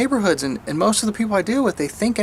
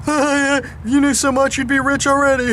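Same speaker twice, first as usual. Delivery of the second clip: mock the manager's smug jab, pitched way up; mocking dumb manager voice; 7 semitones higher than usual. Manager sneering at employee asking for training, high mocking voice. Manager mocked in retold quarrel.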